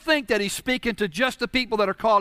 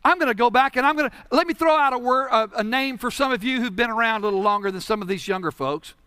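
ordinary talk, nothing marked

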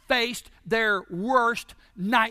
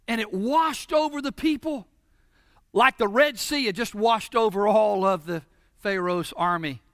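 ordinary delivery

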